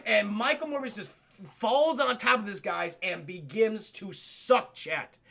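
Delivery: ordinary delivery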